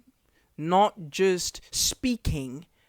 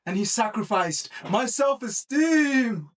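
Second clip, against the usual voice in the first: higher-pitched mock chess commentator; Levy; 4 semitones higher than usual. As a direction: exclaim it like a dramatic chess streamer. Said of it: "if Levy just popped up in the comments, like".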